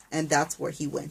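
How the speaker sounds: plain reading voice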